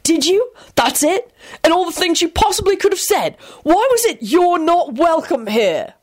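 high-pitched, scathing rant; furious. A narrator's reading. George berating Quentin over his apology, furious. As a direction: challenge him in outraged disbelief. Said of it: "George hissed furiously".

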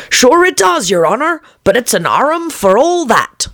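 high, lilting stubborn servant; servant Pat. A narrator's reading. Pat insisting to the Rabbit, high and lilting. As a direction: insist politely. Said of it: "a voice she had never heard before".

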